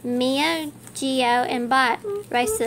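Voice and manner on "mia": plain reading voice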